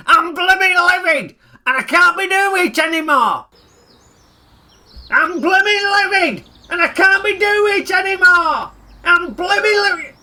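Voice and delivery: high, hammed-up shout out the window; mock shouting. Ray shouting his slogan at the neighbourhood, mock outraged. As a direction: yell it like a fed-up mob. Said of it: "Shout it!".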